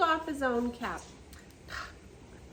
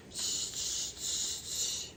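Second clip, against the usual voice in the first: mocking shh; 4 dB quieter, much slower than usual.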